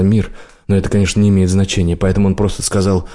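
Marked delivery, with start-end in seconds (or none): none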